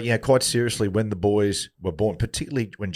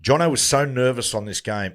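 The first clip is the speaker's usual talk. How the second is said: ordinary talk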